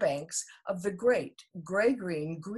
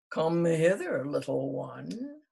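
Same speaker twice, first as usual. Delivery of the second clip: crocodile; 2 semitones lower, 3 dB louder, slower than usual. A narrator's reading. Crocodile speaks.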